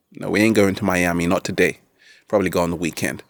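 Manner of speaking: normal talking voice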